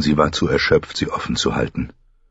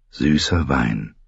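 slow, lower, lingering on süßer; slow savoring; 4 semitones lower, slower than usual. A narrator's reading. Narrator savors the sweet wine, slowly and lower. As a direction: draw out süßer as if tasting it.

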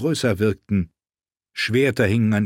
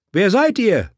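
raised loud call; 10 semitones higher, 5 dB louder than usual. A narrator's reading. Woman calling out to riders in the snowstorm. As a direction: call out over the storm. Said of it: "rief sie".

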